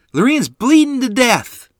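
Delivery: very high, very loud shriek. A narrator's reading. Boy shrieking for his mother in panic. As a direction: scream for her in terror. Boy screaming about bleeding baby.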